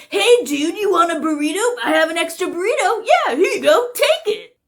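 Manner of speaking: high-pitched, fake-generous voice; pushy prankster. Prankster offering a stranger an extra burrito, pushy and high. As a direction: pitch it up, climbing as you insist.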